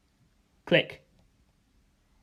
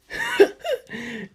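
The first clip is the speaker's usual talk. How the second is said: laugh. Acted. Stephen laughs in flight.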